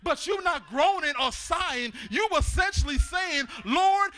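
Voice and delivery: loud, driving sermon delivery; full-force preaching. Real, not acted. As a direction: preach it hard to the congregation.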